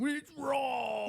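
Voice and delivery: high, drawn-out mock wail; exaggerated complaining cry, stretched out; mocking the critics. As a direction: imitate outraged commenters in a high whine. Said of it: "and they go".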